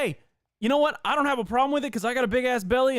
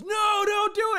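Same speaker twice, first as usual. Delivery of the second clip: screamed warning; 11 semitones higher than usual. Retold or imitated. Teammates scream at the runner leaning to steal. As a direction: scream at him not to go.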